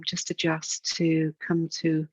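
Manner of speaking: ordinary talk, nothing marked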